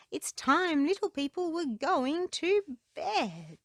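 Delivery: high bird voice, slowing at the end; a kindly rook calling the little ones. Acted. Rook telling little people it's bedtime, high and slow. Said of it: "says the rook".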